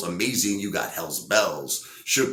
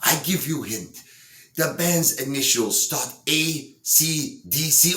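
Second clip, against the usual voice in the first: high, imitated German accent, spelling it out slowly; hammy German accent with deliberate letters; 8 semitones higher than usual. German lady giving the singer a hint, in a mock German accent. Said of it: "I can't do a German accent".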